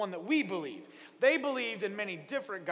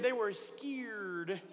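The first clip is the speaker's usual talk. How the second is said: very slow, softer drawn-out scared; level two scared; 8 dB quieter, much slower than usual. Shown as a demonstration. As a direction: stretch "scared" out slowly and quietly for effect. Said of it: "they were level two scared".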